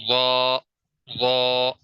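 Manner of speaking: "وا" said heavy and full-mouthed; heavy full-mouth letter. Shown as a demonstration. Tajweed teacher shows students the heavy "وا". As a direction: fill the mouth for "وا". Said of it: "وا کو پر کر کے، موٹا کر کے".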